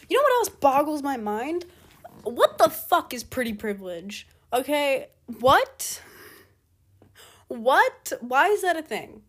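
loud, high-pitched disbelief; incredulous rant. Real, not acted. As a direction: demand an answer, loudly baffled. Incredulous rant about pretty privilege.

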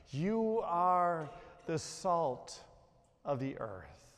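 very slow, fading on "of the earth"; slow deliberate reading. Shown as a demonstration. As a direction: weigh every word. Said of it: "take a look at the first two words".

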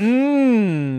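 loud, high pleased mmm; high savouring hum. Acted. Cheddar licks up the last bit with a high pleased hum. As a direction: hum over the last bite.

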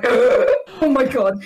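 hammed-up appalled reaction; mock horror. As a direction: draw it out in pretend shock. Retells cartoon character's horrified reaction.

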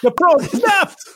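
high, slow melodramatic wail; mock panic. Announcing the snapped probe like a TV medic, mock panic. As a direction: stretch it out in hammed-up alarm.

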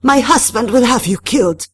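high, loud, angry threat; desperate threat. A narrator's reading. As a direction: lash out at your tormentor. Madame threatens while in pain.

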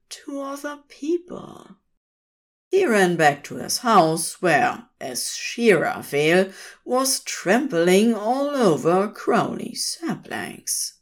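plain reading voice

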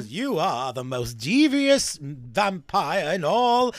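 hammed-up slow vampire intro, rising high; playful, theatrical vampire voice; mock vampire announcer.

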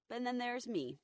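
ordinary talk, nothing marked

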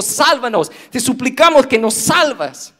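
high, loud pleading cry; desperate shout. Retold or imitated. Crowd begging Jesus to save them, shouting. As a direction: beg to be saved at full voice. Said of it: "estaban gritando".

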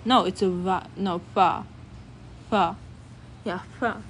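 ordinary talk

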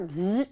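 short buzzing blender noise; imitated blender buzz. Parent teacher showing food going in the blender, imitated buzz.

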